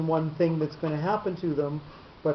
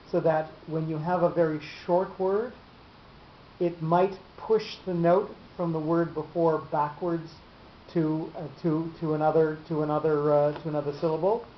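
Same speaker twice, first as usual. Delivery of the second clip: ordinary talk, nothing marked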